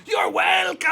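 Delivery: loud, high, cheerful boom; a big, bright booming reply; voice of the dark. A narrator's reading. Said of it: "said the dark".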